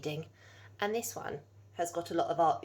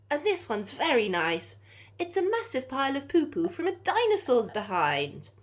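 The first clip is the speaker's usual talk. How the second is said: higher, louder showman voice of a gushing collector; eccentric collector; 6 semitones higher, 7 dB louder than usual. Acted. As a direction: ham up an excited poo collector. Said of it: "a man in a cravat ... said".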